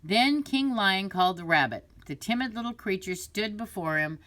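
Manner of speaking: ordinary delivery, nothing marked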